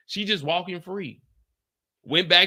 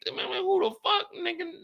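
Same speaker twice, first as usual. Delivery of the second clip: much higher disbelieving squeal; incredulous. Real, not acted. About the trial, a high incredulous outburst.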